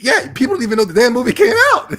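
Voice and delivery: high, loud shout breaking into laughter; loud, high-pitched incredulous hoot; laughing disbelief. Real, not acted. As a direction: yell it out incredulously, then crack up. Laughing disbelief about the movie.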